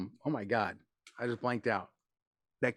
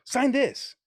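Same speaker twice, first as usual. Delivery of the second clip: mock pushy fan; 11 semitones higher, 5 dB louder than usual.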